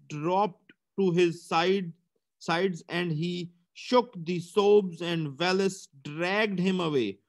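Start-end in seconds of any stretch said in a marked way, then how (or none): none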